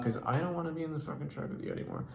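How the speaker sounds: normal talking voice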